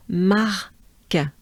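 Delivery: marque split slowly, mar, then a released k; French marque in two slow parts; broken down. Shown as a demonstration. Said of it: "you can break it down".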